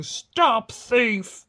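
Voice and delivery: loud angry farmer's shout. A narrator's reading. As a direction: yell it after the thief.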